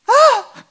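short high yelp of fright; loud, high gasped scream; startled scream. Acted. Fishermen spotting a figure in the storm, startled scream. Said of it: "Someone screams".

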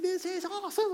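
put-on high, excited kid cheer; kid's delight. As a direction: squeal it like a thrilled boy. Son celebrating the goal.

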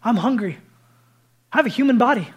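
a cheerful risen Jesus asking for food; high and loud, casually hungry; Jesus voiced warmly. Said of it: "wanted to make it emphatically clear".

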